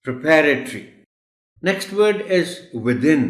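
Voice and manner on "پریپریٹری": پریپریٹری as "pri-PA-ruh-tree"; short "pri", stressed second syllable in پریپریٹری; correct پریپریٹری. Shown as a demonstration. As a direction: repeat پریپریٹری firmly, loud on "PA". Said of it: "اور وہ word ہے".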